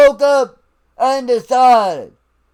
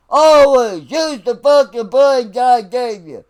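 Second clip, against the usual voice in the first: usual speaking voice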